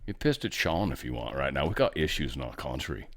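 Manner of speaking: very low, soft mock-British grumble; bad British accent. Host jokingly threatening anger at listeners, bad British accent. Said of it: "that's a bad accent".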